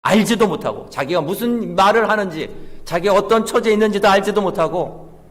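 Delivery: high, loud, insistent pulpit delivery; forceful preaching. Real, not acted. Preacher telling congregation of man cursing God, high and forceful.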